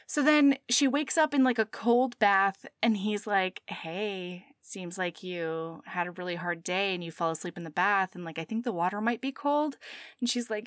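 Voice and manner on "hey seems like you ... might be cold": low, soft, careful voice; acted tender concern, hushed; gentle waking. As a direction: softly coax someone awake. He gently wakes her.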